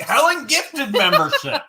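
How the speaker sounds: delighted squeal, pitch jumping high; thrilled, laughing as you say it; excited. Real, not acted. Big gift just announced on stream.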